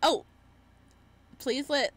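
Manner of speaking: ordinary delivery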